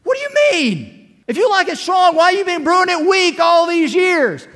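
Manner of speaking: high, huffy mock of an annoyed wife; ticked-off wife. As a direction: squeal it out as the miffed wife. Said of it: "that just kinda ticked her off".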